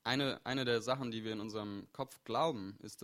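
ordinary talk

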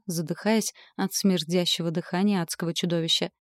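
usual speaking voice

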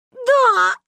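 very high, loud wail; sobbing confession. A narrator's reading. Child confessing to mother, sobbing. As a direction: wail the admission. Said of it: "зашлась я в плаче".

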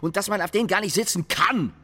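heated complaining, pitched high; indignant rant. Acted. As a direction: pile up the numbers with rising outrage. Colleague ranting about office chairs.

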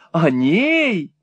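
a startled mother's echo; astonished cry. A narrator's reading. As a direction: cry out in mild disbelief, without much extra volume. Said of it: "вскричала миссис Никльби".

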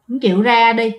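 ordinary delivery, nothing marked

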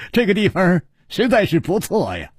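acted chuckle into high-pitched amused remark; laughing. A narrator's reading. Host Chu Liuxiang admiring the room before two guests, laughing. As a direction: laugh first, then speak up high with amusement. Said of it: "楚留香笑着说".

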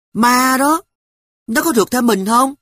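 put-on high, loud scared little girl; a small girl begging for reassurance; frightened child. Girl to grandmother, high frightened child voice.

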